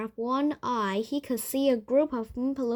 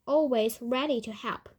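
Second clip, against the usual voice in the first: ordinary delivery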